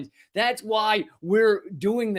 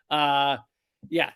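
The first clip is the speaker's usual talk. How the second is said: ordinary delivery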